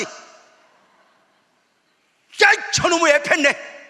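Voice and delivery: loud, high mocking call, slow; mock jeer. Pastor voices neighbours jeering at his son, loud mock.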